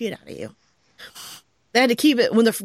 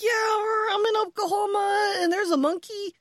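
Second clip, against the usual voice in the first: high, matter-of-fact mock neighbor; imitating the guy; 9 semitones higher than usual. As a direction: play him casually, pitched way up. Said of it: "and he's like".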